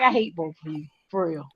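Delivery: fed up with both partners laughing, a low grumble. Retold or imitated. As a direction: mutter it like you're done with them. Retold fed-up line to partners.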